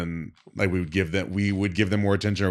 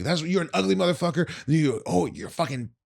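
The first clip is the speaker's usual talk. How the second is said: retold yelling; 9 semitones higher than usual. Fired dancer yelling at the manager, retold rant. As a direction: rattle off insults in imitation. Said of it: "she's just yelling at him".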